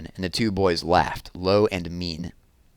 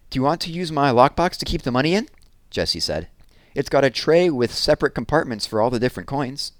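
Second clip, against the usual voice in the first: put-on higher, eager little-girl voice; young girl Jessie; 6 semitones higher than usual. Little sister Jessie eagerly offers her lockbox to her brother, higher girl voice.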